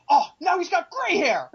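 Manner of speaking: high, loud exclamation of surprise; mock exclamation. Fan quotes favorite riff from the show, mock exclamation. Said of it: "Serco just exclaims".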